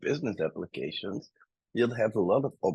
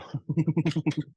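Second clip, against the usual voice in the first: short laugh; 9 semitones higher than usual. Real, not acted. Laughs at chat.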